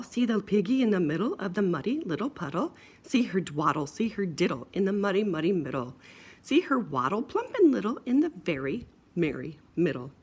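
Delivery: plain reading voice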